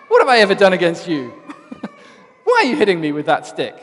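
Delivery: mock a cheeky donkey, loud and quick; Eddie Murphy donkey. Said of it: "in the voice of Eddie Murphy, which I'm not gonna try".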